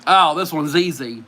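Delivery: ordinary talk, nothing marked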